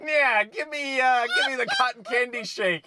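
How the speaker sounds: a hint of a sleazy mustache twirler; mustache twirling villain. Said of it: "twirling his mustache, like".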